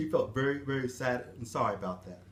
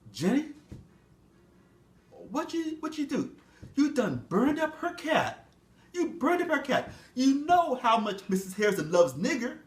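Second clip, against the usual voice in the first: a higher scolding master's voice; 8 semitones higher than usual. Acted. Master scolding Jenny over the burned cat, higher voice. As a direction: scold her like a disappointed master.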